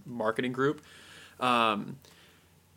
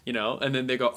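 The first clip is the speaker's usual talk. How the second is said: ordinary talk, nothing marked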